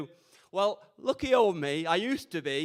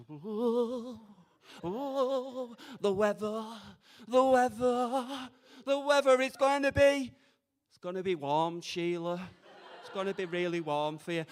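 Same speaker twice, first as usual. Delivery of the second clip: mock fortune teller; 2 semitones higher, 7 dB quieter, slower than usual. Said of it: "I'll get me magic crystal weather ball out".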